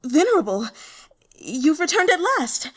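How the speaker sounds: high, loud, stammering cry of relief; kneeling subordinate crying out to her returned master; cried out. A narrator's reading. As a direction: burst out, overwhelmed to see him. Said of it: "she cried".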